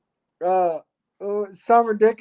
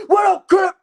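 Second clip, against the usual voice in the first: hyped street character; 4 semitones higher than usual. Acted. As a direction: hype up everyone in the chat like a rowdy hype man. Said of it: "get stuck in character".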